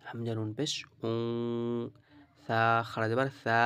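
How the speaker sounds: ordinary delivery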